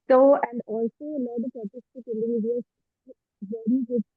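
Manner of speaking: muffled, as if through a hand. Real, not acted. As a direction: speak through a covered mouth. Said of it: "you're very muffled".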